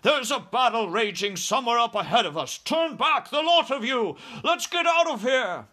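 high, loud panicked officer shouting; alarmed cry. A narrator's reading. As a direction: cry out an urgent order to retreat. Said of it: "he cried".

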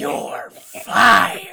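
huge, high, throaty roar in imitation; Vince McMahon. Friend topping the boss's firing line, roaring impression. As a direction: explode on the last word with a throat full of phlegm. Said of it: "should have a bunch of phlegm".

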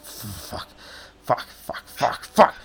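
whispered curses building to full voice; exasperated goblin cursing. Acted. Cornered goblin swears to himself, exasperation building.

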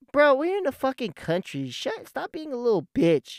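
high-pitched mocking brush-off; dismissive scoffing. Brother refusing his seatbelt in the truck, scornful. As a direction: imitate an indignant brother shrugging off advice.

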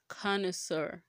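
connoisseur as KAH-nuh-SUR; connoisseur, "kah-nə-sər"; English pronunciation of connoisseur. Shown as a demonstration. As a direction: say connoisseur ending in "sir". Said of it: "that's the one that the English".

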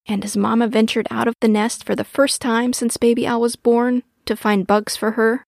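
ordinary delivery

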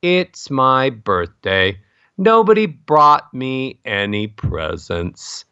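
slow, sad, falling melody; walk each phrase slowly down the stairs, dejected; sad descending melody. Shown as a demonstration. Voice coach shows how falling melody depresses listeners, slow and sad. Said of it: "you sound sad".

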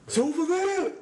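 intense, high shouted butcher cry; menacing butcher. Acted. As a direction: bark it like a crazed butcher. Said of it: "Your face got so intense".

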